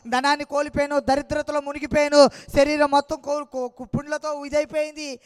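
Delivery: loud, pressing testimony voice; fervent preaching. Acted. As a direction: drive the story home from the pulpit. Testimony preached over music.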